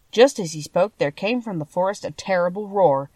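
ordinary delivery

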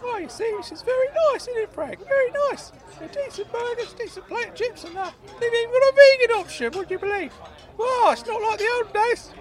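high squeaky praise; high-pitched pleased old uncle voice; delighted Claude. Acted. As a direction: gush in a high piping voice.

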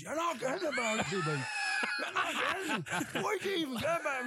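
high shouting mimic of an angry friend; Ryan impression. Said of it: "best Ryan impersonation".

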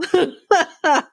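a short high burst of laughter; real laugh. Real, not acted. Cracking up at the retold insult, real high laugh. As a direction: laugh out loud at the joke. Speaker laughs at own retelling.

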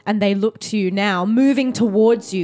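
story narration. A narrator's reading. GM sets a dramatic scene.

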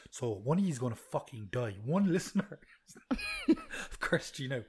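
hushed mock-sinister whisper; spooky whisper. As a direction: whisper a playful curse. Playful curse on listeners.

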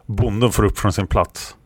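plain reading voice

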